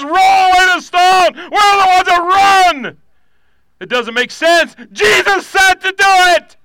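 very high, loud preacher's cry; shouted preaching. Acted. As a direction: shout it out to rally the congregation. Preaching at full force.

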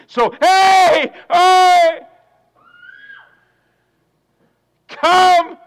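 loud high call of come; God calling out eagerly. Acted. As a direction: shout the invitation across the crowd.

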